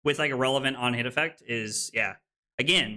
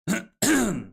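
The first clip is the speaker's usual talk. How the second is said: throat clear; 9 semitones higher, 4 dB louder than usual. Real, not acted. Throat clearing, something stuck.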